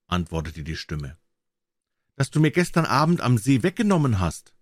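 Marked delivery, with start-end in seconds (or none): 2.1-4.5 high, pleading maiden's voice; pleading enchanted princess. A narrator's reading. Princess asks drummer for her shirt back, high and pleading. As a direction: plead in a higher voice.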